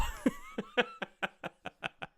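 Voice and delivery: long high-pitched cackle; manic laughter. Real, not acted. Fan after taunting the trolls, manic laughter. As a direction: collapse into helpless laughter. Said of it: "laughing manically".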